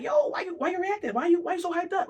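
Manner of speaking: high, mocking voice of someone playing innocent; mock innocent baiter. Mocking the person who baited you, high and innocent.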